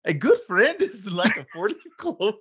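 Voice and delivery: high-pitched cartoon Irish accent, cracking up. Shown as a demonstration. Co-host goaded into trying the quote in a high Irish accent. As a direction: do a hammy, high Irish lilt with a laugh. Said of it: "Try that again in an Irish accent".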